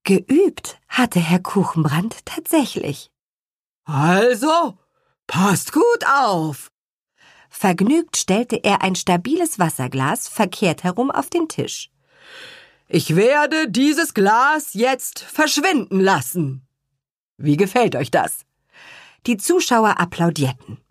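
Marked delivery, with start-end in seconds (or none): 3.8-6.7 lightly voiced showman father. Father performing magic show. 12.9-18.4 lightly voiced showman father. Father announcing his trick.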